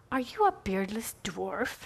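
hushed, slow whisper with a laugh in it; whispered question. Retold or imitated. Retelling the girl's Narnia line, whispered. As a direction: breathe the question like a secret. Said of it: "she says to me".

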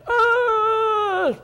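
very high, strained groan of effort; high straining groan. Retold or imitated. Preacher hams up a branch straining to produce fruit. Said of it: "the branch is sitting there going".

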